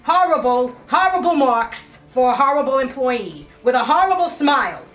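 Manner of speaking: loud, high shouted reprimand; angry berating. Acted. Boss giving employee terrible marks, shouted. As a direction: hammer out each phrase at full volume.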